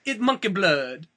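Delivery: bad foreign movie accent; Jon Voight. Villain answers the blood-covered crew in a bad foreign accent. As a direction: put on a hammy, unplaceable foreign accent. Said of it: "I'm doing an imitation of Jon Voight".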